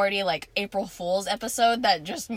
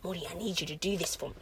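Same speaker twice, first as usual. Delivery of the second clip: whisper; 5 semitones lower, 11 dB quieter than usual. Retold or imitated. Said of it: "'cause the whole time he's like".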